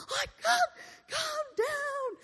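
very high, drawn-out hysterical wail; mock screaming. Wife screaming down the line at the operator, mock hysterics. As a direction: shriek it slowly, as if imitating your own panic. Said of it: "I am screaming down the line at him".

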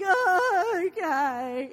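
loud sobbed okay, falling from high; mock tearful giving-in. Son reluctantly agrees with mother, mock sobbing. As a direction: give in through exaggerated child sobs. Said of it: "he's like".

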